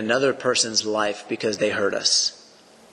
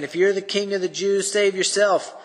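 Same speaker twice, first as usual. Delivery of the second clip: higher jeering taunt; mocking soldiers; 8 semitones higher than usual. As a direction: throw the challenge up with contempt. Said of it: "The soldiers also mocked him".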